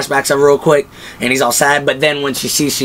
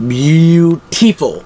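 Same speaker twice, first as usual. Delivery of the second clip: Beautiful stretched out slowly and louder; savoring beautiful; 4 semitones higher, 4 dB louder, much slower than usual. Real, not acted. Fan savoring the battle scene, slow drawn-out Beautiful.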